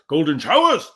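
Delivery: loud, high-pitched hammed-up declaiming with laughs; theatrical mock impression. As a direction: mimic a bad actor, blaring it out. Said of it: "he talks like this".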